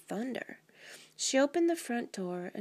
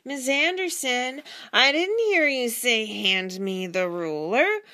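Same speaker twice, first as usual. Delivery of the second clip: loud, slow, raised-pitch protest; child talking in sleep; 5 semitones higher, 8 dB louder, slower than usual. A narrator's reading. Child protests to teacher in sleep, loud and drawn-out. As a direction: draw it out, loudly pleading your case. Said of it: "Even in his sleep".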